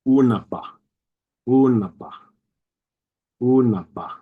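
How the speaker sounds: unaba with the lips fully closed on the b; full double b. Shown as a demonstration. Teacher models unaba with a full b stop. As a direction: complete stop, then release the b in unaba. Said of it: "is pronounced fully".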